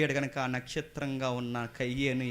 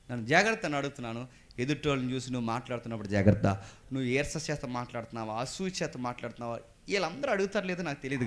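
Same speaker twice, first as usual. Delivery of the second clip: ordinary talk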